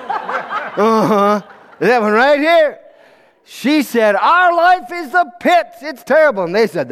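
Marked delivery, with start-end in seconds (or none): 4.1-6.4 exasperated complaint. Acted. Advisor griping to her fellows about their life, exasperated.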